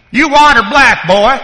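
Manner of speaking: loud, imitated lawman sizing up a stranger; Southern sheriff. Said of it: "a sheriff".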